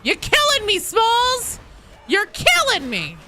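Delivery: high, loud hammed-up complaint; theatrical high-pitched yell; mock exasperation. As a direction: cry out in playful exasperation. Mock complaint quoting a film line.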